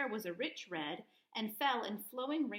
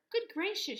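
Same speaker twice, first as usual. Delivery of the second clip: higher startled exclamation; surprised cry; 8 semitones higher, 4 dB louder than usual. A narrator's reading. Dorothy landing in Kansas, surprised cry. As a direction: gasp it out in wonder. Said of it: "she cried".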